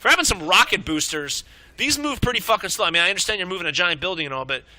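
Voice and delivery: ordinary talk, nothing marked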